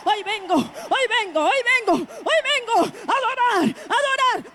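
exultant, repeated shout of praise; healed man praising. Acted. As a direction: chant it out as the cured man coming to worship. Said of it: "voy a decir".

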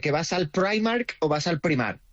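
first "Primark" as English "Praimark", then Spanish "Primark"; two ways to say Primark. Shown as a demonstration. Asks Paloma which "Primark" she says, English sound first, then Spanish. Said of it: "Paloma, ¿tú qué dices?".

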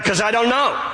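high, lightly acted protest; mock helplessness. Thief protests to questioning angel, mock helpless. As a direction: protest like a clueless newcomer.

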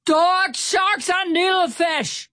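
high, loud sailor's cursing; shouted oath. A narrator's reading. Stout Sam curses at the squealing pig, shouting. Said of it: "Stout Sam shouted".

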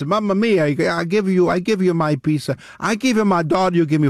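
lightly voiced Italian New Yorker accent; a hint of a hammy Italian; jolly italian pizza chef. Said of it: "that's the Italian from New York".

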